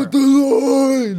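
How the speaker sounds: high, slow rallying call; rally the troops, drawn out; battle cry. Acted.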